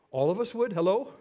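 ordinary talk

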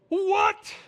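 sudden high, loud startled what; a preacher's staged gasp of wonder; mock astonishment. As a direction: yelp it in wide-eyed disbelief. Preacher feigns astonishment at burning bush.

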